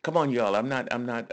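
ordinary talk, nothing marked

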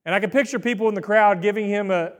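usual speaking voice

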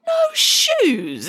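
very high, drawn-out disbelief; shocked exclamation. A narrator's reading. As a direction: exclaim it aghast, pitch leaping up. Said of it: "exclaimed Hannah Piggins".

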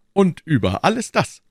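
high, loud exclamation; feigned enthusiasm. A narrator's reading. D'Artagnan toasting with the guard, carried away by enthusiasm. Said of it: "rief D'Artagnan, als wäre er von Begeisterung hingerissen".

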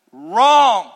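loud, drawn-out Wrong with falling tune; loud firm denial; emphatic wrong. Real, not acted. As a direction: slam the word down. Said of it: "emphatic rebuttal".

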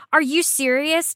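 ordinary talk

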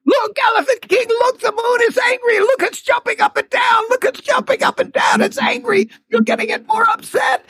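very high, urgent calls from the side; squeaky little rabbit crying out in alarm; high-pitched frantic warning; rabbit calling out. A narrator's reading. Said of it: "the rabbit started calling from the side".

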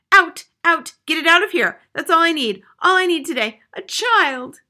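very high, very loud tirade; hysterical, high-pitched outburst; outraged shrieking. A narrator's reading. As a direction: shriek them out of the room. Invalid ordering the intruding child out.

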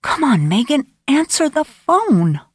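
pleading. A narrator's reading. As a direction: urge her to answer. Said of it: "Carly thought".

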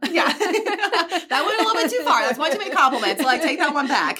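laughing while talking; high giggly voice. Real, not acted. Laughing banter.

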